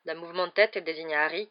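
ordinary delivery, nothing marked

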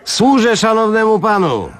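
a high, fawning exclamation; bow and chirp it up high like a servile waiter; eager waiter exclaiming. A narrator's reading. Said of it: "wykrzyknął kelner".

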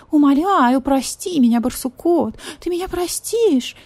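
imagined pleading cat voice; purring plea. Retold or imitated. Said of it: "и будет мурлыкать".

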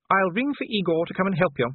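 high, firm wifely voice; brisk wife. A narrator's reading. Sybil offers Igor to help Vimes dress, brisk high voice. Sybil speaks to her husband.

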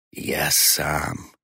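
winded, hoarse protest; wheezed, out of breath. A narrator's reading. Old man failing to catch up with the girl, wheezing. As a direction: rasp it out while short of breath. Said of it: "прохрипел в одышке Корки".

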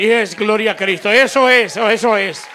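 ordinary talk

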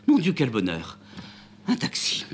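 high, loud joyful cry. Acted. Amanda spots the taxi, cries out with joy. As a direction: burst out with delight at seeing the taxi. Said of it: "pousse un cri de joie".

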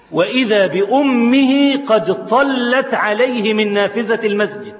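ordinary talk

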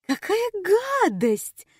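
very high, slow disgusted shriek; disgusted cry. Acted. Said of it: "вскричала Нина". (вскрикнув с отвращением)

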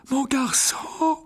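put-on high, slow kindly old wizard. A narrator's reading. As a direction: speak gently to the boy like a benevolent old headmaster. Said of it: "d'un ton bienveillant". (ton bienveillant de vieux sorcier)

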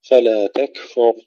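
تكفر with the silent kaf breathed, no echo; whispered kaf. Shown as a demonstration. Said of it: "الكاف الساكنة نهمسها". (همس الكاف في تكفر)

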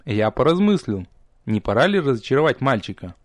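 usual speaking voice

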